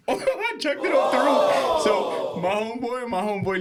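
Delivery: can barely get it out for laughing. Real, not acted. Laughing while telling the throw.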